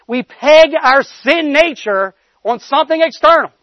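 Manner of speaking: fervent sermon climax; full-force preaching. Acted. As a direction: drive the call home like a preacher.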